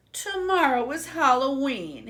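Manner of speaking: high, lonely pig voice; mildly voiced sad pig. A narrator's reading. Inventor pig alone before Halloween, sad high voice. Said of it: "he thought sadly".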